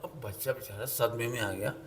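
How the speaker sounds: ordinary talk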